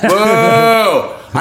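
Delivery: high, drawn-out boo; mock heckling. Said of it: "no one heckles".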